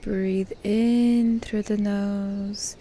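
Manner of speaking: "in" stretched long and swelling; drawn-out breathing cue. Shown as a demonstration. Guide cueing listeners to breathe in through the nose, drawn-out breathing cue. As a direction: pace the inhale slowly, holding the word "in".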